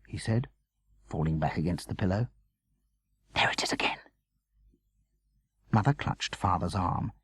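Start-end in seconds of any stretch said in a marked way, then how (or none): 3.3-4.0 frightened whisper in the dark. A narrator's reading. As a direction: breathe it so no one hears. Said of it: "whispered dialogue at night".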